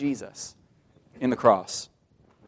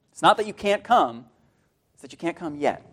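ordinary delivery

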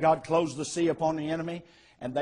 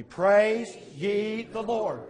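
very slow, loud, raised call; leading congregation; 5 semitones higher, 5 dB louder, much slower than usual. Shown as a demonstration. Preacher getting the congregation to say it with him, slow and loud. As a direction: stretch it out loudly for everyone to join. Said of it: "Say that with me".

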